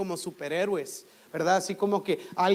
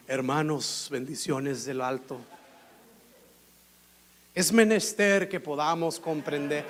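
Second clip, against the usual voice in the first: lower, solemn churchy pulpit voice; mock pompous preacher; 6 semitones lower than usual. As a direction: ham up a stuffy preacher on the microphone. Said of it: "Cálmese".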